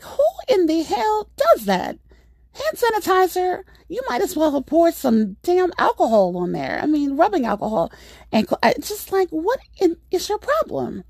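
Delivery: high-pitched, exasperated disbelief; incredulous rant. Retold or imitated. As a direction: retell it as an appalled telling-off. Ranting at neighbor's stupidity, retold.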